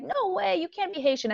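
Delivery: high-pitched incredulous imitation; mock disbelief. People doubting she is Haitian, high incredulous mimicry. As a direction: imitate someone refusing to believe it, voice jumping up.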